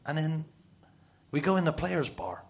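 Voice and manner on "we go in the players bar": ordinary delivery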